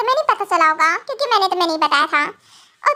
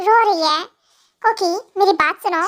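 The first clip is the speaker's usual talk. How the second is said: usual speaking voice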